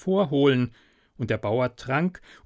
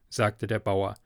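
usual speaking voice

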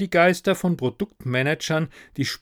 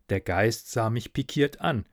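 ordinary delivery

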